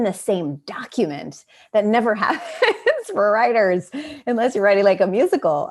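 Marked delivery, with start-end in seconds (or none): 1.7-5.7 laughing through the words, higher and louder; a real laugh bubbling up mid-sentence; amused, giggling aside. Real, not acted. Laughing while praising co-writers.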